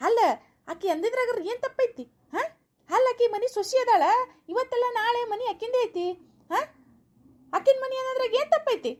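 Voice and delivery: high-pitched quarrelsome challenge; indignant arguing. Acted. Husband argues back in family quarrel. (ಎತ್ತರದ ದನಿಯಲ್ಲಿ ಜಗಳದ ವಾದ)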